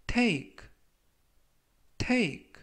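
clear, careful model of take, said as teik with a falling close; model pronunciation. Shown as a demonstration. Teacher modelling the word for students, careful pronunciation. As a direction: read take slowly for students to repeat. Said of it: "take라고 발음하시면 되겠습니다".

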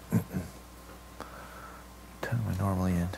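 low, near-whispered aside to self; soft, whispery question to yourself; muttered aside. Real, not acted. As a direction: think aloud under your breath.

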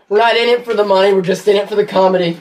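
quick mock promise; fast playful delivery; fast joking pitch. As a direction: rattle it off fast.

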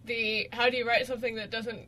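ordinary delivery

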